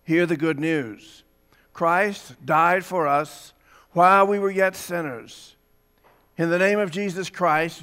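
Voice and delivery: measured, solemn worship-leader voice; liturgical reading. Acted. Pastor leading the congregation's confession, solemn liturgical read. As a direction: lead the congregation through confession.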